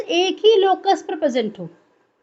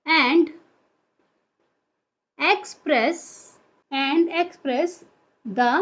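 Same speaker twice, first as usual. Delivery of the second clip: slow word-by-word dictation with long pauses; dictation pace; much slower than usual. Real, not acted. Teacher gives students the allele definition to note, dictating slowly.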